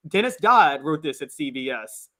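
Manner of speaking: usual speaking voice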